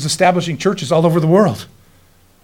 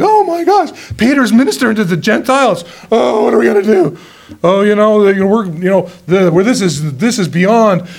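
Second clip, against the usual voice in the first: panicked apostles; 3 semitones higher than usual. Retold or imitated. Apostles panicking over Peter.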